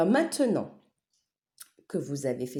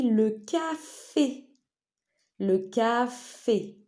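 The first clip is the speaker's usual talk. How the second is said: "café" as CA-FÉ, raised and drawn out; syllable-by-syllable; 5 semitones higher, much slower than usual. Shown as a demonstration. Teacher asking pupils to listen, syllable by syllable. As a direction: split "café" into two long syllables.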